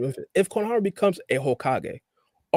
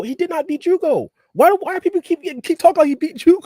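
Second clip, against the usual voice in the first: exasperated protest; 12 semitones higher, 4 dB louder than usual. Real, not acted. Exasperated protest, much higher.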